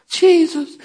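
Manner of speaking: high, mock-timid little utterance. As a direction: say it like a half-hearted worshiper, high-pitched. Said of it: "they weren't just".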